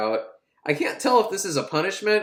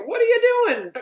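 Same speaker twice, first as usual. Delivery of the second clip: very high, squeaky mock voice; squeaky reporter; 4 dB louder than usual. Prison reporter pestering people, squeaky mock voice. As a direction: squeak it like a nosy little reporter. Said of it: "He's like".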